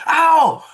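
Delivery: high loud yelp of pain; mock pained cry; sharp ow. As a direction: yelp like you just got shot. Cousin shot in leg.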